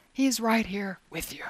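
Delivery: a hushed promise, no voice; reassuring whisper. Real, not acted. Preacher assuring listeners God is near, whispered. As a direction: whisper it close and tender.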